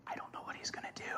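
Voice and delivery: imitating a wife's uncertain whisper; barely audible, voiceless aside; worried whisper. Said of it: "she had this look on her face like".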